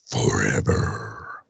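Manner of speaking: very low, slow, drawn-out word; mock-ominous voice. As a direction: intone it like a dark prophecy. Mock-grand voice, robot lives forever.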